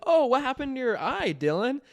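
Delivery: high, concerned teacher asking about a bruise; teacher's voice. Retold or imitated. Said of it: "the teacher was like".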